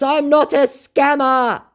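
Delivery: high, slow, put-on whiny scammer denial; mock scammer voice. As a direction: drag it out like a wounded fake. Scammer denies it, voiced by narrator.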